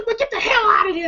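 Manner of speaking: high shouted line through laughter; laughing mock shout. Smokey chasing the neighbor off, laughing shout. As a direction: yell it while cracking up.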